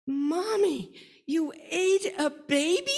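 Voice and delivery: put-on high, loud, slow child's disbelief; a little boy asking his mommy in alarm; shocked little boy. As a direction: gasp it like a startled toddler. Small boy shocked at mother.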